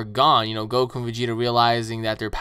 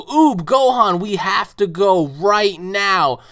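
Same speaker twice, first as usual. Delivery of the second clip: urgent call; 6 semitones higher, 7 dB louder, much slower than usual. Retold or imitated. Goku urgently rallies the others.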